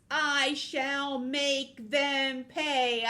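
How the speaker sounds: loud, high, very drawn-out threat; villainous climax. Acted. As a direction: stretch every word into a villain's proclamation. Villain vows revenge, climactic take.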